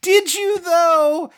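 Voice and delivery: high, long-drawn disbelief; mock sceptical retort. Challenging the witch's warning, high drawn-out scepticism. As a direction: stretch it out high, doubting her. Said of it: "And I'm like".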